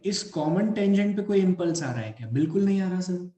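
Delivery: normal talking voice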